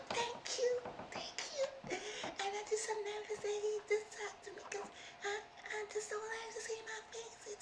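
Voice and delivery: put-on high, soft, bashful girl's voice; shy girl voice. Retelling the shy girl thanking him, high and soft. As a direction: mimic a timid girl barely able to speak.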